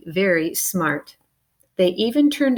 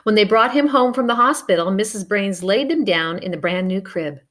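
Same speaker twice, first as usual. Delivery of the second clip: ordinary delivery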